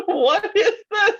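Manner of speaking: high, squealing laughter; laughing disbelief. Real, not acted. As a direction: laugh through the question. Shocked by the birthday picture.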